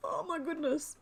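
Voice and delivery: high, weak from laughing; helplessly amused sigh; laughing to tears. Real, not acted. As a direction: catch your breath after a laughing fit. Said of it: "I'm laughing so much".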